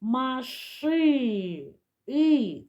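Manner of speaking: "маши" with the last vowel held, then the vowel alone; slow "маши", then a lifted standalone "І"; picking out next sound. Shown as a demonstration. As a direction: stretch the final vowel of "маши". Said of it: "Наступний звук".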